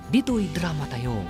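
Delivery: ordinary talk, nothing marked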